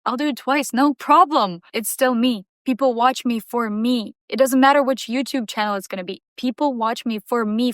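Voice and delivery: normal talking voice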